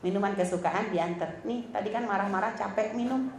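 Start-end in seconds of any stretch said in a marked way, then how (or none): 1.4-3.3 soft, gentle words to a husband; a loving wife offering a drink, much softer; tender offering. Retold or imitated. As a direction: soothe him softly with a drink. Wife serves husband syrup tenderly.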